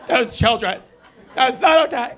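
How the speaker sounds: hammed-up schoolteacher scolding; scolding teacher. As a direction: tell off the class like an outraged teacher. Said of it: "looking at me like a teacher".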